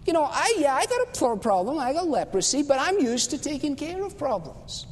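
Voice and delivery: high, affronted voice of a proud commander; mock-outraged general; indignant Naaman. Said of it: "So he was enraged".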